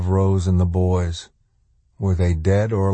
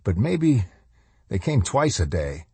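usual speaking voice

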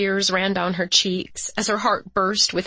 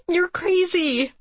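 laughing through tears, slow and high; tearful laugh; 12 semitones higher, slower than usual. A narrator's reading. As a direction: giggle it out while teary. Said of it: "She sniffled through her smile".

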